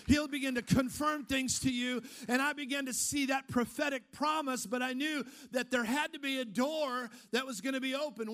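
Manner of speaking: impassioned preaching over music; preaching at full force. Acted. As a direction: lift the crowd with the testimony.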